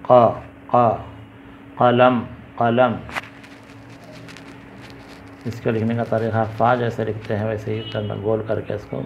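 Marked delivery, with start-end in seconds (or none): 0.1-3.0 emphatic back-of-throat क़ in क़लम, each repeated; qaf pronunciation. Shown as a demonstration. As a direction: model क़ and क़लम with a uvular q. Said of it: "ताकि इसका pronunciation सही है".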